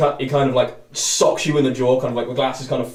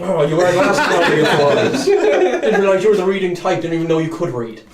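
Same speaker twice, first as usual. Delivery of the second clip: laughing mockery; 6 semitones higher, 6 dB louder than usual. Tiefling mocking Garv.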